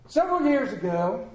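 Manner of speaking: ordinary delivery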